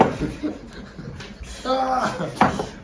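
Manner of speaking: high breathy pompous laugh; hammed-up hearty laugh; blustering toff laugh. Laugh burst.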